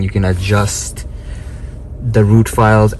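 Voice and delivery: usual speaking voice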